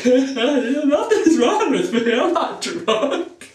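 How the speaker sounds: high, slow, giggling through the words; laughing denial. Retold or imitated. A giggling boy tells the teacher he isn't drunk, laughing. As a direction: deny it while cracking up. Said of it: "I can't stop laughing".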